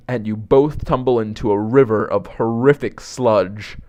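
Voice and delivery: ordinary delivery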